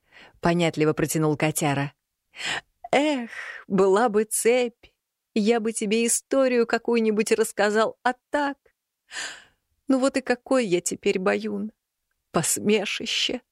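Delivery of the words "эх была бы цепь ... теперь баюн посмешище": a fat cat lamenting; sad cat voice. A narrator's reading. (жалобный кот, медленно)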